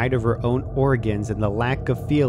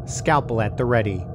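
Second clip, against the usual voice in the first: plain reading voice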